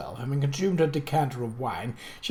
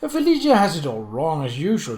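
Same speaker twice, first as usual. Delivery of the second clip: usual speaking voice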